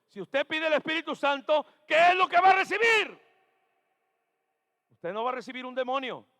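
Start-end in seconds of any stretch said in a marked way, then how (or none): 0.1-3.2 much louder and higher, pressing for an answer; loud call-out. Shown as a demonstration. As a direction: shout it so the back row answers. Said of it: "No lo escuché".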